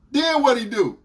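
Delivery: dead serious. Retold or imitated. Boyfriend pushing for the next detail, dead serious. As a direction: press on angrily. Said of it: "dead serious".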